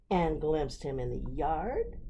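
usual speaking voice

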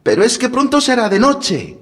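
put-on high, pleading small girl; little girl voice. A narrator's reading. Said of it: "respondió la niña".

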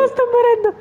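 squealing with laughter; cracking up, high and breathless; laughing. Real, not acted. Cracking up at the tomato.

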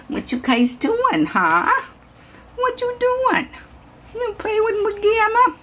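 very high coo at a puppy. Real, not acted. High baby talk to puppies.

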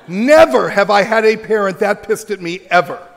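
loud, punchy storytelling; emphatic. Real, not acted. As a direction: hit it hard, still amazed. Telling of furious parent.

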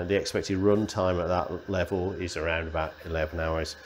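ordinary talk, nothing marked

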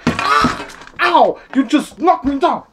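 high, loud mock pain; pained yelling. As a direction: yelp as you hit the floor. Santa knocked over, yelling.

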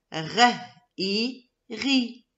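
R, then I, then blended into "ri"; teacher blending "R", "I" into "ri"; sounding out. Shown as a demonstration. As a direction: say the sounds "R" and "I" apart, then join them as "ri". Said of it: "la lettre R avec la lettre I, ça fait ri".

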